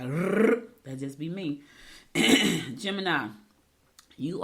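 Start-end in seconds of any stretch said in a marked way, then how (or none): 0.0-0.6 loud rolled mock-gabble noise; spoofing her own motor-mouth rattle; imitated rapid babble. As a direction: trill a blur of excited fast talk. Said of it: "when I get excited, it be like".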